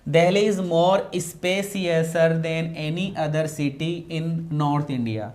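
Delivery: wrong sentence read out very slowly; slow dictation. Shown as a demonstration. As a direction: drag out every word for the class. Teacher reads out test sentence.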